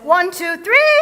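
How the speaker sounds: loud count, three shouted high; rousing count. Shown as a demonstration. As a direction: build the count to a big rallying three. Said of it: "I had to add".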